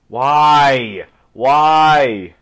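drawn-out, loud cry of why; loud wail. Real, not acted. Gamer protesting a setback, loud drawn-out wail. Wailing over the game going wrong.

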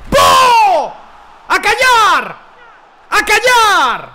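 high, loud yell to silence the crowd; shout. Real, not acted. Streamer screaming at the crowd after his goal. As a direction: scream it out in triumph.

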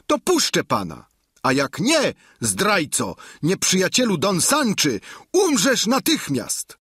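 pin him down and make your terms; Sancho, threatening squire. A narrator's reading. Sancho voiced throughout. (wysoki, głośny, groźny głos giermka)

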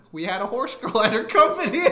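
usual speaking voice

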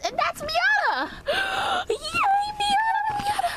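excited shout. Acted. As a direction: cry out with relief. Joyful reunion calling out.

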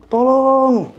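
mild mock shout of tolong, pitched high; retold cry for help. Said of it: "Gua teriak, Bang". (teriakan minta tolong yang ditirukan)

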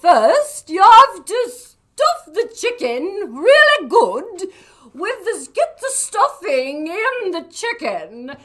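high, loud warbling cooking-show voice; sing-song old TV chef; Julia Child impression. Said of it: "I actually have a Julia Child bit".

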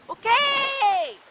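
high, long drawn-out whoop; triumphant cheer. Real, not acted. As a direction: stretch it out in victory. Succeeded in getting squid home. (높고 길게 끄는 환호)

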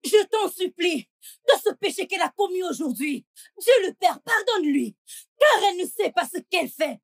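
high, fervent supplication; crying out in prayer. Acted. Woman praying outdoors for a sinner, high fervent cries. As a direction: cry out to God, ending on ringing amens. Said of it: "pourquoi es-tu dehors à crier et prier".

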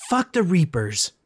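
loud, high furious shout; shouted curse. A narrator's reading. Cursing the reapers to the empty sky, furious shout. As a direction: hurl it up at the sky. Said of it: "I shouted to the skies".

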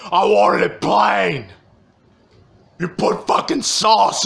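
high, loud, slow furious rant about sauce; screaming customer. Retold or imitated. Customer screaming at staff over sauce on his ribs, loud and high.

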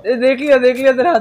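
excited. Real, not acted. Excited spotting the enemy.

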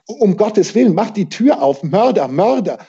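high, urgent pleading cry; desperate shouting. A narrator's reading. Peter begging to be let into the inn, shouting. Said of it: "schrie er".

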